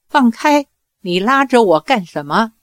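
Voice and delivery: ordinary delivery, nothing marked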